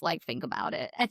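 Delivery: normal talking voice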